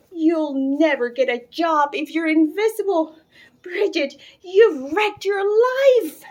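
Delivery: loud, high, building despair, slowing at the end; distraught tirade. A narrator's reading. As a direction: rant it as the world ends. Mother lamenting invisible daughter.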